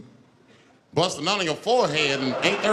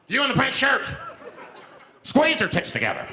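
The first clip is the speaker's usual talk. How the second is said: high, raised-voice order; barked demand; 8 semitones higher than usual. Acted. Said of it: "he starts making demands".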